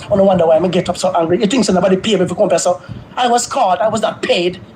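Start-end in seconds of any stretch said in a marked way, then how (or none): none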